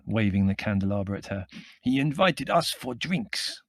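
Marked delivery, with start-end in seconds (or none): 1.8-3.6 slow, high Middle European voice; tall foreign figure. A narrator's reading. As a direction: announce it grandly in a foreign accent. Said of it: "said the tall figure".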